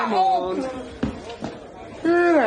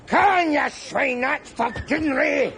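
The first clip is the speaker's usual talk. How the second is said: vengeful yelling; 6 semitones higher than usual. Acted. Hits out and shouts revenge.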